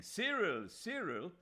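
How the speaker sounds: high, loud call across a crowd; crying out a name. A narrator's reading. Aziz calling to Cyril from a carriage, loud cry. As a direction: shout his friend's name. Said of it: "Aziz was crying".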